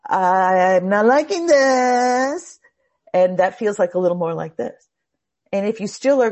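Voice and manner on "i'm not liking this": loud, drawn-out whine rising at the end; escalating inner complaint. Acted. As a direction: stretch it out, higher and louder, as a nagging warning. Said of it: "If you don't listen the first time, it starts to go".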